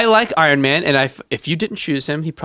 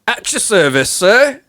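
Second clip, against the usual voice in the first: higher put-on British accent, rising at the end; Cockney accent; 8 semitones higher, slower than usual. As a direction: play a Cockney servant. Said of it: "like, a Cockney accent".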